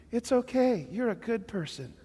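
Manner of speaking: soothing, seductive mock demon voice; sweet-talking demon. Singer showing how demons really sound, soothing put-on voice. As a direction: coax like a demon posing as a comforter. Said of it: "They sound like this".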